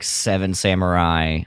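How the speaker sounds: ordinary talk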